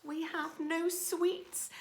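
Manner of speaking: high, slow, small voices; high, meek little ants; timid ants. Acted. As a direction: squeak it out sadly. Ants' timid reply.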